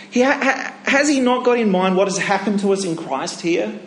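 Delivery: ordinary talk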